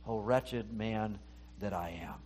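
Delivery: low, slow, soft lament; Paul's lament. Acted. Paul confessing he listens to his flesh, low slow lament. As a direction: sink into a quiet, heavy self-reproach. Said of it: "we're like Paul saying".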